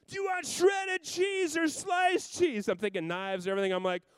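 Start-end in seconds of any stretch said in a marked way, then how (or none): 0.1-2.7 put-on high, very slow child's question; higher, slow and louder little girl; little daughter. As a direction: draw it out like a sweet kid. Daughter asking about cheese.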